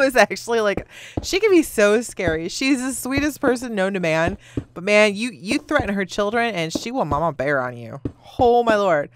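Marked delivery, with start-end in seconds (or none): none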